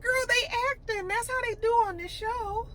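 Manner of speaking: very high acted aside; high-pitched aside. Telling Tia they are only acting, high-pitched aside.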